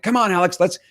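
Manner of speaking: put-on dad hurrying his four-year-old out the door, exasperated call; retold frustrated call. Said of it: "my voice started to raise".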